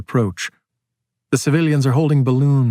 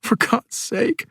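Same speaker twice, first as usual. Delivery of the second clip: breathy, high, crying whisper; woman pleading with the officer through tears; tearful plea; 11 semitones higher, slower than usual. A narrator's reading. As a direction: beg through tears, slow and barely voiced. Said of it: "she's starting to cry".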